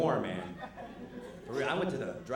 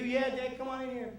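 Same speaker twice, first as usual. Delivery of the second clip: put-on much higher kid voice; imitated kid; 11 semitones higher than usual. His son inviting dad in, much higher kid voice. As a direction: chirp it like a happy little boy. Kids answering.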